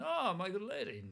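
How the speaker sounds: high, loud gallant hobbit; Bilbo greeting. Acted. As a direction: exclaim a delighted greeting to a lady. Bilbo's gallant greeting acted in scene.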